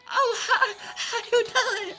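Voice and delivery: very high, slow, falsetto greeting; nervous lady voice. Retold or imitated. Scared ex-coworker greeting him in the store, high falsetto. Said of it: "I should do that voice".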